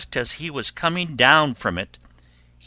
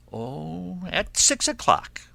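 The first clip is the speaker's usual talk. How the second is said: coaxing wolf; 7 semitones higher than usual. Acted. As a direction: stretch the first word, then lift high. Wolf answers casually.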